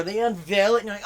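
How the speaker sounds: ordinary talk, nothing marked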